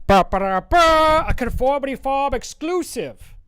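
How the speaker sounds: high, loud fanfare then announcer; hammed-up hype announcement; mock fanfare. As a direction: trumpet it like a big reveal.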